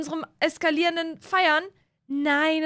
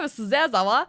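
usual speaking voice

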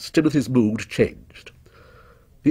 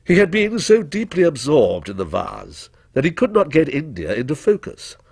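usual speaking voice

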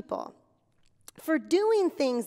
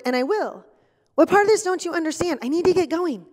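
louder, fast, fed-up snapping at the caller; exasperated; 2 semitones higher, 7 dB louder, faster than usual. Acted. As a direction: hurry them off the phone, clearly irritated. Acted annoyed phone call.

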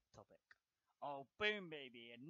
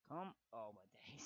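ordinary talk

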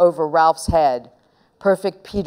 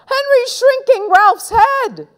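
high, slow, loud wail; 5 dB louder than usual. A narrator's reading. Little brother telling on his brother, high loud wail. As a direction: wail the tale to mum, drawn out. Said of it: "wailed Peter".

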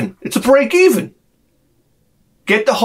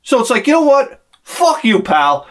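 ordinary delivery

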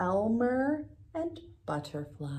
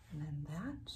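usual speaking voice

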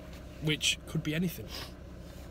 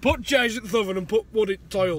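mock Yorkshire accent; 4 semitones higher, 7 dB louder than usual. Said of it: "dippy Yorkshiremen".

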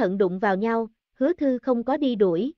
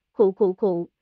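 harsh wounded coughing fit; pained cough; 4 semitones lower, slower than usual. Acted. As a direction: cough like you're hurt inside. Wounded man coughing again. (ho sặc sụa vì bị thương)

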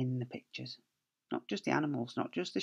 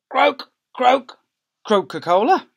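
higher, loud froggy croaks; croaking frog; 10 semitones higher, 13 dB louder, slower than usual. Acted.

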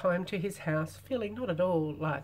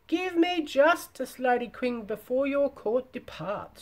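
crying out; 7 semitones higher, 6 dB louder than usual. A narrator's reading. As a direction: cry it out as the old hag demanding justice before the court. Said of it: "she cried".

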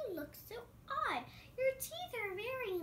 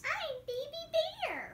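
higher, little bear voice; Baby Bear voice; 10 semitones higher than usual. Acted. Child playing Baby Bear, higher little bear voice. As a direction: pipe it up like a small bear cub. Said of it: "acting out as that player".

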